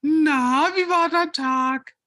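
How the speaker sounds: high, slow sweet housewife; wife voice. Acted. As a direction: chirp it like a doting wife. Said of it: "du bist meine Frau".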